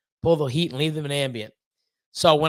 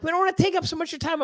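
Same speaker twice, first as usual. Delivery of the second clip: high, quick mock protest; imitating polite visitors; 11 semitones higher, much faster than usual. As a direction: retell it fast in a raised, polite voice.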